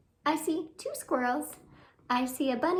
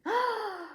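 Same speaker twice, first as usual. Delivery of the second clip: gasp; 7 semitones higher, 3 dB quieter than usual. A narrator's reading. Storyteller reacts to new find.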